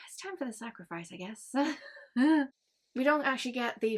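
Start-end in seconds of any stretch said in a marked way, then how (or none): 0.1-1.5 soft, fast sinister mutter; a light mock-sinister murmur; mock menace. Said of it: "the lawnmower man going". 1.6-2.5 a quick amused laugh; brief real chuckle; short laugh. Real, not acted. Laughs at own retelling.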